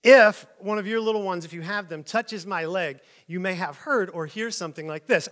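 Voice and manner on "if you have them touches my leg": ordinary talk, nothing marked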